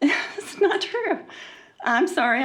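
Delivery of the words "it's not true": emotional laugh. Real, not acted. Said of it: "I'm emotional about it".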